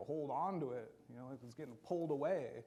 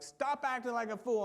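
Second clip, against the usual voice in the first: higher, louder stern scolding; scolding parent; 8 semitones higher, 7 dB louder than usual. Retold or imitated. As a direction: snap at the kids to knock it off. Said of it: "Sometimes I'm like".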